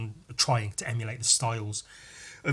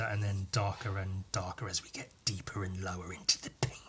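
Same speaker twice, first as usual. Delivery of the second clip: soft unvoiced whisper; 5 semitones lower, 9 dB quieter than usual. Real, not acted. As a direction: whisper it as it gets deeper. Describing the darkening gradient.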